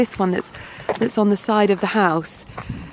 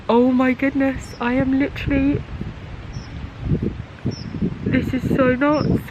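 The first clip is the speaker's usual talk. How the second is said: higher, breathy real disbelief; overwhelmed excitement; 4 semitones higher than usual. Real, not acted. Still overcome in the garden, breathy real disbelief. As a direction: gasp and marvel, still overcome. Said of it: "that was a bit overwhelming".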